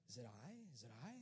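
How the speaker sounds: low, hushed disciples' question; timid asking. Acted. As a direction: ask meekly, under your breath. Disciples nervously ask after betrayal news.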